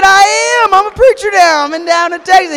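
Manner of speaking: high, loud, eager insisting; insistent shout. Retold or imitated. Proud young preacher shouting at doubting friend Sean. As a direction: yell it like he has to believe you.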